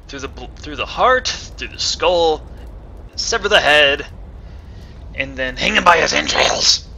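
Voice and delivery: building from firm to loud and grisly; mock dark vengeance, swelling; grim revenge. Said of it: "that was kind of a bit dark there".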